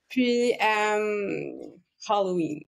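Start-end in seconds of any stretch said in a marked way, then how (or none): 2.1-2.6 Halloween in English, with aspirated H; English accent. Shown as a demonstration. Guest showing off her super accent, English pronunciation with aspirated H. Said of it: "accent anglais ou accent québécois?".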